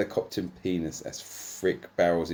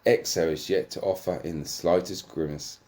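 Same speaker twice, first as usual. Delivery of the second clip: usual speaking voice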